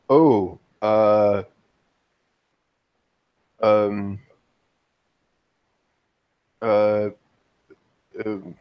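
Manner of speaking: halting, long pauses between fillers; hesitant and thrown, searching for a reply; confused. Acted. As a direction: stall awkwardly, lost for words. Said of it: "she just looks confused at him".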